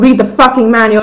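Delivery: loud, emphatic retold order; exasperated retort. Host repeating himself to a confused guest, exasperated. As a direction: raise your voice on an impatient order.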